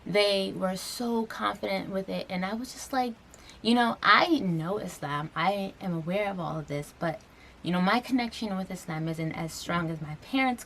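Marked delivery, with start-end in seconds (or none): none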